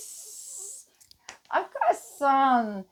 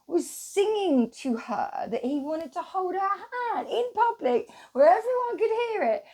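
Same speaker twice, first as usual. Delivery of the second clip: usual speaking voice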